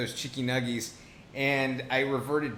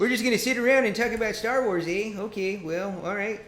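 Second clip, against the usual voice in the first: put-on Canadian accent; 7 semitones higher, 3 dB louder than usual. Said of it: "nobody can do a Canadian accent like".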